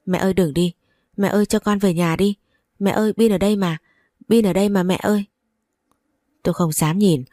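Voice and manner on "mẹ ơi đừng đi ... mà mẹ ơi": usual speaking voice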